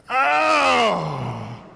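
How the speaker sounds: long falling howl, put on; mock cry of pain. Friend pretending to share the toothache, mock howl. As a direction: fake a stab of toothache.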